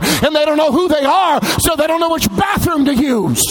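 driving pulpit cadence climbing into a shout; impassioned preaching. Real, not acted. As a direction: build phrase on phrase to a high cry. Preacher builds on identity crisis.

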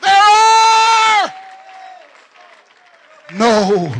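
drawn-out, very loud preaching wail; preacher's cry. Real, not acted. Preacher crying out to the congregation, full-force wail. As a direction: cry it out high, then drop on the last word.

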